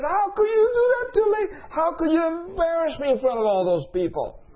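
very high screech of outrage, easing slightly; imitated angry yelling. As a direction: act out a livid woman's shrieked reproach. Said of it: "She's yelling at me".

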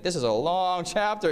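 sighing mock groan at a long chapter; lightly mocked boredom; mock weary complaint. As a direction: whine like a bored reader. Mocking a bored reader.

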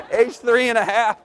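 laughing. Real, not acted. Laughing at son's remark.

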